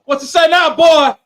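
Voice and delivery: put-on The Rock saying boy. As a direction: bark "boy" like a wrestler taunting. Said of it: "hearing The Rock say "boy".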